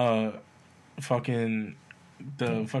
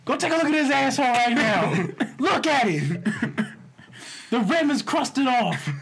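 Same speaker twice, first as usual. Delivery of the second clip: mock whiny son; 10 semitones higher, 4 dB louder than usual. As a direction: ham up the son ranting to ma. Mock son still ranting.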